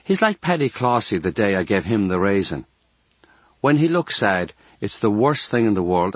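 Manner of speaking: plain reading voice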